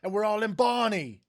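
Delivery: high, loud hammed-up Cockney voice; Cockney impression. Quoting Ocean's Eleven, loud mock Cockney. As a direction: belt it out like a stage Cockney. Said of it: "from Ocean's Eleven".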